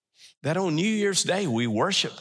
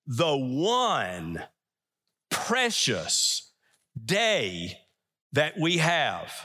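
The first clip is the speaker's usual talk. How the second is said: word-by-word emphasis; 2 semitones lower, much slower than usual. Retold or imitated. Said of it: "we started saying".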